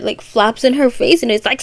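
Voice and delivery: ordinary delivery, nothing marked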